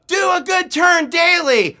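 high, loud, slow chanted slogan; drilled group shout, drawn out; shouted chorus response. Retold or imitated. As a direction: yell it like a troop answering in unison. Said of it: "a chorus of".

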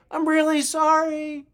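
high, thin call as from far off; distant apology. Retold or imitated. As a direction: mimic someone calling sorry while drifting away. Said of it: "I think I heard him say".

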